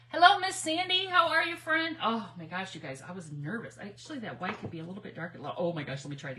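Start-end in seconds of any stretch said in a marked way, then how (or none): 0.1-2.0 high, loud happy hello; high bright greeting to a viewer; excited greeting. Real, not acted. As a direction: call out to a friend joining in.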